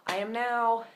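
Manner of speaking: ordinary talk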